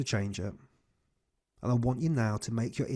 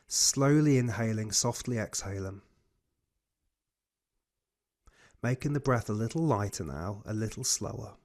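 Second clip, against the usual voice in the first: guided breathing cue. Acted. Coach leads breathing exercise.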